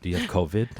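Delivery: laughing through a baffled question. Retold or imitated. Husband puzzling over pregnancy tests, laughing. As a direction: ask it while cracking up. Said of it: "I'm like".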